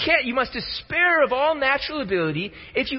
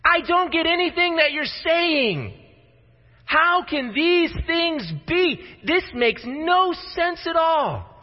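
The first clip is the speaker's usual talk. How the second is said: exasperated; 7 semitones higher, slower than usual. Acted. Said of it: "Nicodemus is saying".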